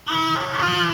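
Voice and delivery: long high loud scream; startled yell. Acted. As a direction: yell out on one high held note. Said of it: "and she yelled".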